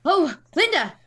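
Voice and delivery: loud, high, startled exclamation; alarmed outburst. Acted. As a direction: blurt it out in alarm. Alarmed reaction to Linda's screaming.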